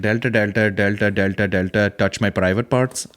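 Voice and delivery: put-on Indian accent, running through filler words; mock Indian accent. Imitating the woman being interviewed, mock Indian accent. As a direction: lightly caricature an Indian interviewee. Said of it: "But this woman's talking. She's like".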